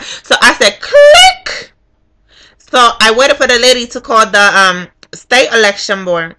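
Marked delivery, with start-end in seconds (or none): 0.8-1.7 high, sharp sound-effect word; mock hang-up. Voter hanging up on the official, mock hang-up. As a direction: squeal the phone click.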